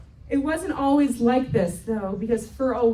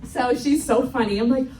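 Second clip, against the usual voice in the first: ordinary talk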